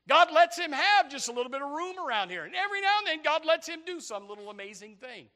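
high, loud preacher voice; impassioned sermon pitch; forceful preaching. Real, not acted. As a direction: raise it high to press the point.

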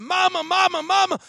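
high, loud imitation of a boy calling out; excited calling. Willie runs down the hall to mama, calling excitedly. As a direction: holler like an overjoyed kid running indoors.

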